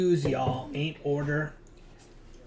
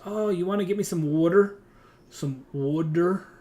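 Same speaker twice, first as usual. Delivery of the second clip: loud, raised New Jersey "water", vowel like "wood"; New Jersey accent; 5 semitones higher, 8 dB louder than usual. Retold or imitated. Player mimicking coworker asking for water, New Jersey accent. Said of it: "my coworker is from New Jersey".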